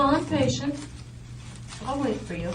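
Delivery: ordinary talk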